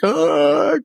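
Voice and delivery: high-pitched mock sob, "ah"; imitated crying wail. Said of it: "just crying and just".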